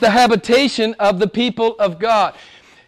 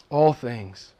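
low, soft repeat for weight; hushed echo; 9 semitones lower, 6 dB quieter than usual. Real, not acted. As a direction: drop right down and let it sink in.

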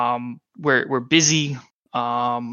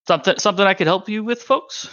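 higher, quick greeting that lifts at the end; perky deputy; 6 semitones higher, faster than usual. Acted. Deputy greets the walk-in visitors, perky. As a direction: chirp it brightly, rising on the last word.

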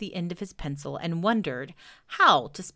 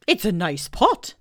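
Owl voice; 6 dB louder than usual. Acted. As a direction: boom it out like a pompous owl. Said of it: "said Owl".

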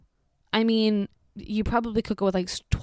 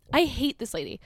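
ordinary delivery

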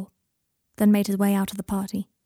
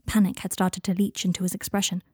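plain reading voice

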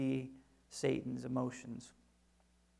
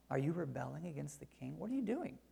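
soft, taunting enemy voice rising into a sneer; mock intimidation; 7 dB quieter than usual. Preacher mimicking Sanballat's intimidating taunt, softly mocking. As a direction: mimic the enemies bullying the builders. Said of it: "an attack of intimidation".

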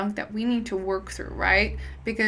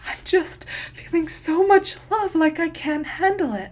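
imitating a student, higher and overwhelmed; 9 semitones higher, 4 dB louder than usual. Student channeling for the first time, mock overwhelmed. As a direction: voice a student swept up by love, light and breathless. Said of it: "they start going".

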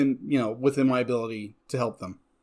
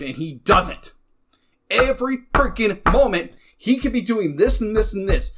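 building heated rant, a bit louder; getting worked up over a selfish character; frustrated rant that keeps climbing; upset rant; 4 semitones higher, 3 dB louder than usual. Real, not acted. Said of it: "I understand you're upset".

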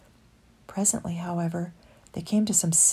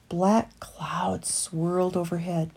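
ordinary delivery, nothing marked

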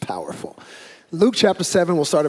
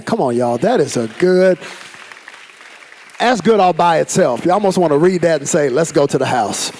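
louder, fired-up preaching; excited; 3 semitones higher, 8 dB louder than usual. Real, not acted. Pastor hypes congregation over the reading, loud and excited. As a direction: fire up the crowd.

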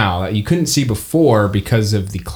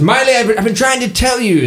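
high, exasperated elf; pitched way up, pleading to be heard; 12 semitones higher, faster than usual. Acted. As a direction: elf calls back while walking into the fog. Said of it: "He says".

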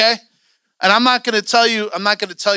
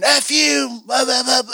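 higher-pitched mock cursing outburst; blurted, raised-pitch heckle; mock angry shout; 6 semitones higher, slower than usual. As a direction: imitate someone yelling a curse. Hearing an angry curse in service.

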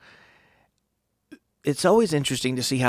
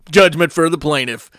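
loud, emphatic pronouncement; mock ruling; 3 semitones higher, 10 dB louder than usual. Friend answering Frank with a courtroom verdict, mock official. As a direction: deliver it like a booming verdict.

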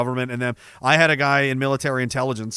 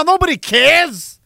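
high, loud, slow, stretched-out yell; angry shout; 12 semitones higher, 6 dB louder, slower than usual. Real, not acted. Fed-up streamer shouting at a chatter. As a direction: really let the frustration out. Streamer ranting at a super chat.

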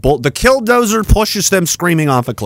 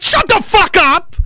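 high, drawn-out angry yell; slow, high-pitched furious outburst; 14 semitones higher, slower than usual. Real, not acted. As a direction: cut them off with an exasperated shout. Cuts off the mocked complainers.

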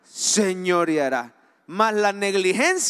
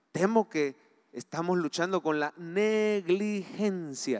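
ordinary delivery

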